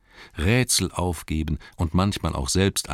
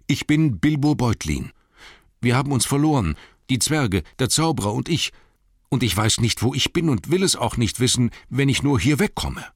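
high, loud, nervous hobbit voice; anxious hobbit; 8 semitones higher, 4 dB louder than usual. Acted. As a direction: plead to get away.

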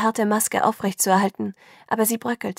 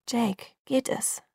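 slow, softer worried question; 3 semitones higher, 4 dB quieter, slower than usual. A narrator's reading. Bella asking shaking Jacob, softly worried. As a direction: gently check he's all right. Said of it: "fragte ich besorgt".

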